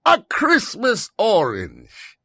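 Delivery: mock cheer. Father's forced cheer.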